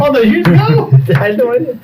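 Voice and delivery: high voice of disbelief; hammed-up wounded astonishment; mock dismay. Character on dad's lie from years ago, in mock dismay. Acted shock at father's lie.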